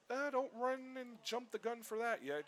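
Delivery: high, slow cautioning voice; imitated hedging. Microsoft staffer cautioning an eager visitor, imitated hedging. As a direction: put on a staffer gently backing off.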